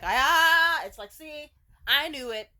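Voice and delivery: loud, drawn-out aha of realization; bursting with I knew it; a loud, slow triumphant aha; gotcha realization. Real, not acted. Sudden realization exclamation.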